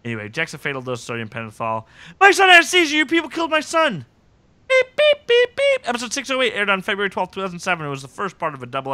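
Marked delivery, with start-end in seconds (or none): 2.2-4.1 high, loud hammed-up yell; acted outrage, pitched way up; mock outraged accusation. Acting out a grieving, accusing parent. 4.7-5.9 very high, loud clock beeps; squeaky even beeps, voiced by mouth; imitated beeps.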